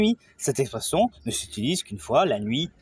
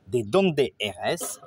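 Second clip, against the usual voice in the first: slow, clear Spanish; slow model phrase; 2 semitones lower, much slower than usual. Shown as a demonstration. Teacher models a question for learners: slow. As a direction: say each word apart for learners. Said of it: "fiches de vocabulaire".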